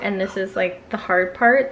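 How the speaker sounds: ordinary talk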